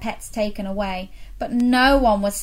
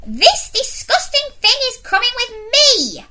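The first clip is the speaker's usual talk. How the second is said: much higher, louder disgusted bellow; bellowing headmistress; 6 dB louder than usual. Acted. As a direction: roar the order in disgust. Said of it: "bellowed the headmistress".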